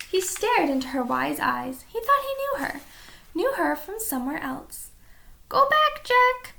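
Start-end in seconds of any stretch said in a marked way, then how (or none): 5.5-6.5 high parrot squawk. A narrator's reading. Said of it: "she said".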